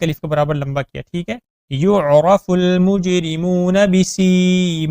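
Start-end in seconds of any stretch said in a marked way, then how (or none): 1.7-4.9 slow melodic tajweed chant; Quran recitation. Shown as a demonstration. Recitation demo.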